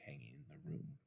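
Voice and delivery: whispered, hushed storytelling. A narrator's reading. Narrator recalling the eerie portrait, in a hushed whisper. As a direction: drop to a whisper as the portrait appears. Frightened boy sees portrait.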